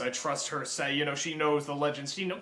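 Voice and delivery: mock-serious low drone; deep mock voice. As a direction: drop way down like a grave believer. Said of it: "He's just like".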